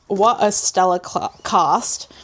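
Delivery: ordinary talk, nothing marked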